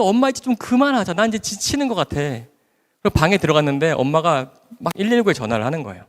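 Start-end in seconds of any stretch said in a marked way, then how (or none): 0.0-2.2 weary exasperation. Retold or imitated. Exhausted daughter pleading to mother.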